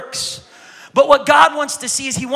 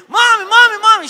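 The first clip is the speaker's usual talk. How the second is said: put-on high, pleading little-kid voice growing louder; insistent child calling; 12 semitones higher, 8 dB louder than usual. Little girl tugging her praying mom's dress, calling high and insistent. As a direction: whine for mom's attention.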